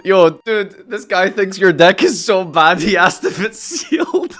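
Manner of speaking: loud laughing. Real, not acted. Mocking friend's bad deck.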